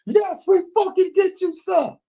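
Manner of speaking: high, far-off chorus of soldiers shouting back; troops answering sir. Retold or imitated. Recruits answering their commander with a high yell. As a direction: answer like recruits barking at a drill sergeant.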